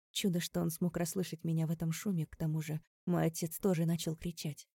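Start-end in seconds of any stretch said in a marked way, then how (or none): none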